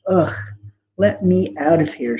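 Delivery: usual speaking voice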